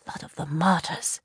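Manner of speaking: softly stunned oath; whispered. A narrator's reading. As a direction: breathe it in shock. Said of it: "Karn whispered".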